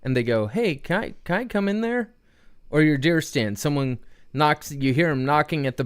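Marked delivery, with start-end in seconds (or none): 0.5-2.1 higher-pitched hopeful request; pleading stranger. Acted. Stranger knocking at the duck blind asks to come in, higher-pitched request. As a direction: ask it in a higher voice, like an uninvited hunter.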